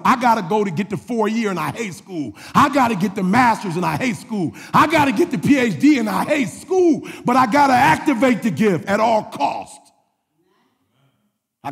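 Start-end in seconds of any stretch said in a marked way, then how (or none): 0.0-9.9 repeated cadence climbing higher with each line; building preaching. Real, not acted. Preacher on activating the gift despite hating school, building fervor. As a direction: build line on line to an emphatic peak.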